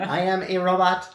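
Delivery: slow, stiff robot speech; toy robot voice. Pretend. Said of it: "Beep, boop".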